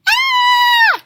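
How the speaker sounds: very high, loud scream; frightened girl screams; Goldilocks shrieking. A narrator's reading. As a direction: shriek in terror. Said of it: "Goldilocks shrieked".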